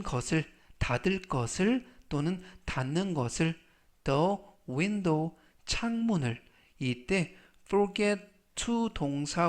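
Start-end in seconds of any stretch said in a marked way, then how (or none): 4.0-5.4 Your belongings said very slowly; slow model reading. Shown as a demonstration. Teacher reading the phrase to students, very slow. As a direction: stretch Your belongings out for learners. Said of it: "slow model reading".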